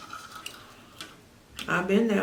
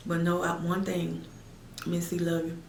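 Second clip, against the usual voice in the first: soft farewell; 3 semitones lower, 8 dB quieter than usual. Real, not acted. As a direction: say it gently, like a goodnight. Soft closing farewell to viewers.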